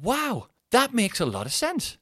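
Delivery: high, slow, exaggerated wonder; drawn-out fake awe; mock amazement. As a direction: ham up being impressed. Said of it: "And I went".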